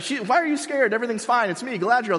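high, hammed-up heroine voice; a parody of a cocky elf queen; mock Galadriel. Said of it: "And, like".

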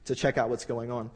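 ordinary delivery

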